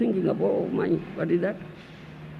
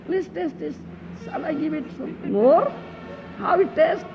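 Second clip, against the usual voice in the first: higher, coaxing wife then delighted husband; playful acted dinner; 9 semitones higher, 5 dB louder than usual. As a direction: act out the doting meal scene. Acts wife serving and husband.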